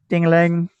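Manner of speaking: high, loud ding-a-ling; shop bell imitation. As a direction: chime like a shop door bell. Game master imitates shop door bell.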